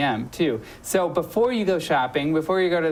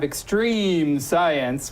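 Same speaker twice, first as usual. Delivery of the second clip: EXTREME stretched out slowly, hype style; drawn-out EXTREME; much slower than usual. Shown as a demonstration. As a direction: draw out the long vowel in "extreme". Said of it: "you have to say it like that".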